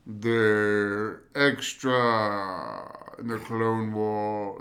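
very slow, drawn-out villain voice; Dooku voice. Retold or imitated. Imitating Clone Wars Dooku for a co-host. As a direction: stretch each word like a menacing count. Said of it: "But just".

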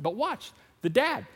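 ordinary talk, nothing marked

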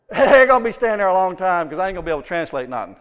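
fast, laughing through the words; laughing while telling. Real, not acted. Preacher laughing to the congregation at his own story. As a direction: chuckle at yourself.

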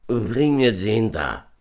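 slow, drawn-out shout; distant call. A narrator's reading. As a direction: call out as if from afar. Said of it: "wie aus weiter Ferne rufen".

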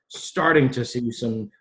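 starting drawn out slow, each syllable pressed; stress on starting. Real, not acted. Guest repeating his key word for the podcast, slow stress. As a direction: land hard and slow on starting. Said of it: "emphasis the word starting".

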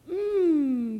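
long, loud kissing "mmm"; contented "mmm". A narrator's reading. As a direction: hum it out loud and drawn out. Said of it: "they all said".

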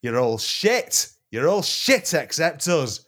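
slow, high jeering yell; mock shout. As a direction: bellow it across the world. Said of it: "wanted to shout".

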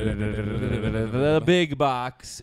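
slow, drawn-out announcer voice. Acted. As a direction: boom it out like a game show host. Drum roll then grand announcement.

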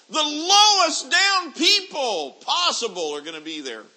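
high, loud hellfire sermon voice; full-force preacher warning of hell; fiery preaching. Acted. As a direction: thunder it down from the pulpit.

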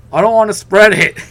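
near-shout, stubborn and high; insistent. Retold or imitated. Insisting to hosts, louder retake.

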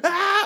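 high, sharp cry of frustration; frustrated yelp. Retold or imitated. Retelling the customer service call, a high frustrated yelp. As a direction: let out a quick exasperated yelp. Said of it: "instead of just going".

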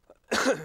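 a sharp real cough; coughing fit. Real, not acted. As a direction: cough once, hard. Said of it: "I'll apologize now for, for coughing".

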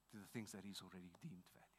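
fast hushed whisper. Acted. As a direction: drop to an intimate whisper. Sermon drops to whisper.

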